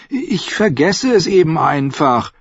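put-on high, whiny boyish voice; whining boy. A narrator's reading. Bran excusing himself to Jojen, high whine. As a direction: whine it like a nine-year-old. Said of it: "jammerte Bran".

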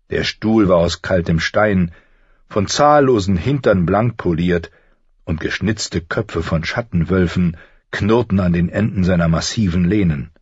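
plain reading voice

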